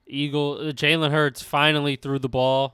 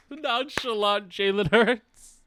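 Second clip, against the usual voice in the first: laughing high; 7 semitones higher, slower than usual. Real, not acted. Laughing at bit.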